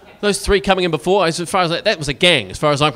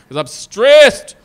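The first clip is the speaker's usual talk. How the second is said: loud, slow, higher outburst of a stressed gamer; 6 semitones higher, 12 dB louder, much slower than usual. Retold or imitated. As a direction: blurt the excuse out loud and drawn out. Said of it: "People go".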